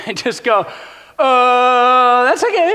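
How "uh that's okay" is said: much higher, squeaky nervous backing down; meekly giving in to the king; mock timid.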